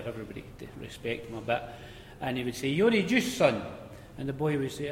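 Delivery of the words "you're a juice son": voicing the greedy manager, higher and louder; bossy pit manager demanding; a bossy manager put on; gruff boss voice. Big Chocolate voiced demanding juice.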